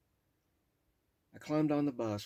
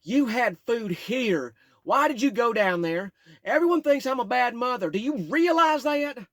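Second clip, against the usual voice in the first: put-on drunk yelling mother; 13 semitones higher, 15 dB louder than usual. Drunk mother yelling at her son: loud high scolding. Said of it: "started yelling at me".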